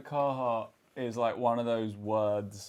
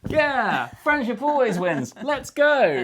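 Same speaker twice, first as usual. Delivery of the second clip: loud, high-pitched rallying cry; big drawn-out over-the-top cheer; hyped cheer; 12 semitones higher, 9 dB louder, slower than usual. Pretend. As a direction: whoop it out like a team chant. Hyped cheer before starting the task.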